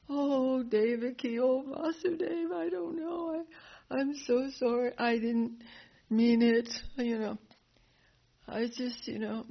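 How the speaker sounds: high, crying mock remorse; hammed-up pleading apology; whiny apology. Kamsa begging Devaki and Vasudeva's pardon, high crying mock remorse.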